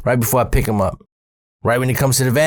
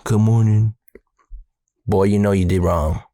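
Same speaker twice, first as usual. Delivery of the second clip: stern dad; 4 semitones lower than usual. Retold or imitated. Paul's dad, lower, stern.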